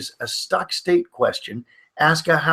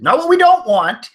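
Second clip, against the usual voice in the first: "don't" punched high and loud; loud, high stress on "don't"; emphatic contrast; 10 semitones higher, 7 dB louder than usual. Real, not acted. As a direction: hit "don't" hard with a jump in pitch.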